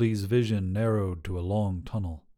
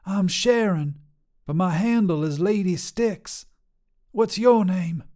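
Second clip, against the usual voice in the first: higher, bold trucker woman voice; tough lady trucker; 8 semitones higher, 3 dB louder than usual. Acted. Trucker Sharon sizing up a hitchhiker, brash and higher. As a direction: call out like a brash long-haul trucker.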